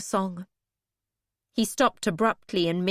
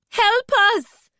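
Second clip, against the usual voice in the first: much higher, much louder scream for help; desperate shrill scream; 13 semitones higher, 9 dB louder than usual. Acted. Alaria screaming for rescue, desperate, high and loud. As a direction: scream to be heard through stone. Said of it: "Alaria's desperate scream was so shrill".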